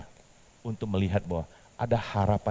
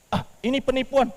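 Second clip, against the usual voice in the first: higher, louder suspicious cry; suspicious exclaim; 4 dB louder than usual. Acted. Petrus suspecting the call is a fraud, exclaiming. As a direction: catch the scam.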